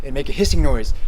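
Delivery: talking through a forceful exhale; blowing out hard. Shown as a demonstration. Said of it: "blow out as hard as you can".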